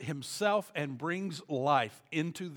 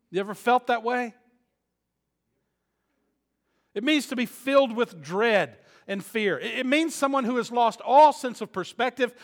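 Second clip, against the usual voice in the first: loud, higher preaching voice; preaching at full force; 7 semitones higher, 12 dB louder than usual. Acted. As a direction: preach it hard to the congregation.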